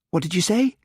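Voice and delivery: Alison, high and sharp, demanding. A narrator's reading. Alison demands Hamish repeat himself, sharply. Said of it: "demanded Alison sharply".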